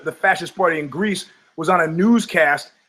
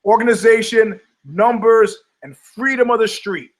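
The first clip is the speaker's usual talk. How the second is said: higher, louder, each word hit hard; hammed-up rally list; emphatic listing; 6 semitones higher, 4 dB louder than usual. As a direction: spell out the Nazi formula with force. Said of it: "what made the Nazis successful".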